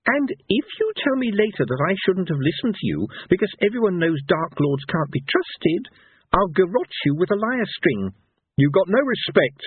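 high-pitched, emphatic warning; threatening minstrel. A narrator's reading. Minstrel threatening a villain with a lyre string, indignant. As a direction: lay down the threat point by point.

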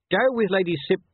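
high, loud command cut short; interrupted order. A narrator's reading. Officer orders corporal over the crowd, loud, broken off. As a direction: call out an order and break off. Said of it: "He stopped because of the rising hubbub".

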